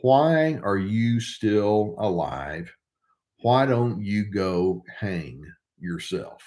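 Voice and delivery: slow, cold, deliberate cruelty; slow cold quote. Retold or imitated. Narcissist taunts a victim, slow and cold. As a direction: let each word drop cold and slow. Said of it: "the narcissist said to me".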